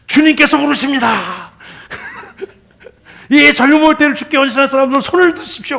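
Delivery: high, loud tearful altar call with a laugh; imitating a weepy revival leader; mock revival preacher. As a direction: ham up an old rally preacher calling youth forward.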